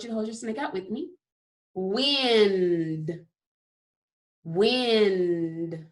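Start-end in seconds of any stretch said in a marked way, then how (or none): 1.7-5.9 drawn-out, stretched word; said slowly. Shown as a demonstration. Teacher stretching the word slowly for young students. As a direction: stretch every sound out slowly. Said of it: "So I'm gonna say it slowly".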